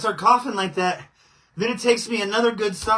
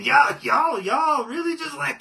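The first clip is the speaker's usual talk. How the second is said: strained shout; 7 semitones higher, 4 dB louder than usual. Real, not acted. Still coughing, calling out.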